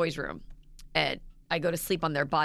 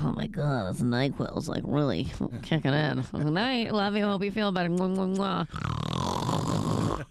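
lower, sleepy drugged voice; drowsy husband; 5 semitones lower, slower than usual. Retold or imitated. Husband knocked out by NyQuil, lower sleepy voice. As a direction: drift off mid-sentence, lower. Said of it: "he's like".

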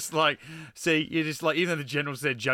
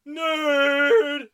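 much higher, louder teasing shout; mock yell; 13 semitones higher, 8 dB louder than usual. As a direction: bellow it to tease. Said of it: "calling him a nerd".

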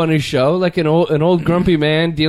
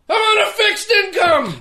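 high mock shouting old grump; hammed-up cranky old man with a laugh; grumpy old man yell; slower than usual. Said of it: "he's just like".

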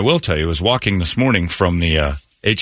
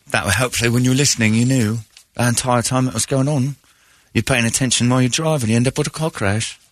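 higher-pitched mock English accent; imitated English accent; 4 semitones higher than usual. Host imitating her voice for listeners, mock English accent. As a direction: keep up a put-on English accent.

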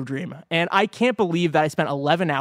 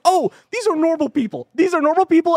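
mock relieved exclaim; 10 semitones higher, 4 dB louder than usual. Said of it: "it's like".

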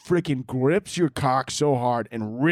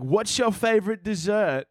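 high-pitched imitation British accent; mock British accent; 7 semitones higher than usual. British street interviewer asking about desserts, mock British accent. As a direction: put on a cartoonish British accent. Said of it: "I keep seeing these British people".

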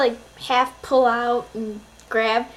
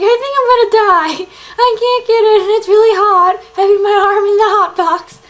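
higher, louder, frantic retold panic; panicked wail; 7 semitones higher, 9 dB louder than usual. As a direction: cry out as if about to die of the heat. Arm stuck in hot box.

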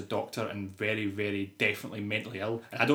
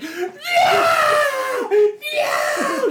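very high, loud mock wail; screaming skier; 11 dB louder than usual. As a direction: imitate an overacted horror scream. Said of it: "the lassie on the skis is like".

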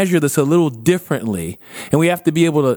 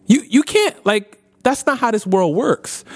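exasperated; 9 semitones higher than usual. Retold or imitated. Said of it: "And Batman's like".

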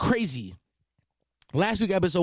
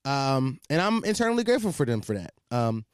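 usual speaking voice

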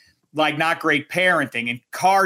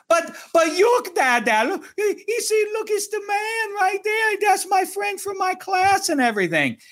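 put-on insistent little girl. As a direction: plead for Dad's attention like a small kid. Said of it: "And she, she's like".

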